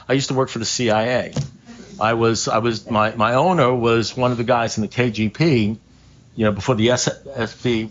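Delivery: normal talking voice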